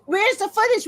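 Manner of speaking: loud, slow, high demand; hurling the question at nobody; indignant outburst. Real, not acted. Ranting to viewers about missing footage, loud and high.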